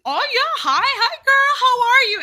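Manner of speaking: high, loud, gushing greeting; overfriendly front desk lady. Retold or imitated. Receptionist trying to be relatable on the phone, gushing. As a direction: squeal hello like an old girlfriend. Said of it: "she's all like".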